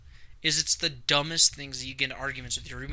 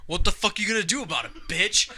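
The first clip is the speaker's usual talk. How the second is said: retold argument taunt; 5 semitones higher than usual. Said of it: "we start going kinda at it".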